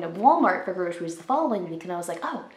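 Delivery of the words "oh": ordinary talk, nothing marked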